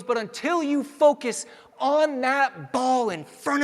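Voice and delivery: high, loud and slowed, pressing the point; a coach insisting a player finally focus; coach's urgent push. Retold or imitated. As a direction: lean in hard like a coach who has had enough. Coach pushing Richie at practice.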